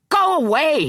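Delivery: high, loud terrified shriek; panicked old man shrieking go away. A narrator's reading. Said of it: "he shrieked".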